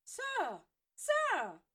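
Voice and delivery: high, loud desperate calls; girl crying out after her teacher; calling out. Acted. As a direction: shout after him, desperate. Granger calls after Severus.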